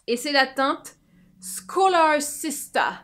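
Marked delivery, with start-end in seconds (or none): 1.4-2.9 put-on drawn-out English-accented shade name; slow, playful English accent; slight English accent. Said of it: "je l'ai dit avec un accent un peu anglais".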